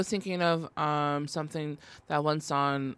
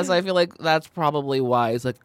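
normal talking voice

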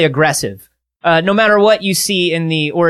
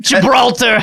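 higher mock-Australian accent; 4 semitones higher, slower than usual. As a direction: toss it out in hammed-up Australian. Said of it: "Can we promise not to do Australian accents".

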